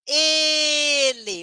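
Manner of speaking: normal talking voice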